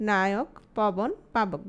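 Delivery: Naik, পবন, পাবক said very slowly, one by one; slow careful listing. Shown as a demonstration. Teacher slowly listing example words for students. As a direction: spell out each word slowly for the class. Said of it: "শব্দগুলো খেয়াল করো".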